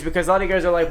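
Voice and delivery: usual speaking voice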